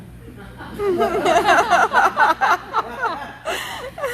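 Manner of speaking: warm rueful laugh; a long amused laugh. Real, not acted. Laughs at own story.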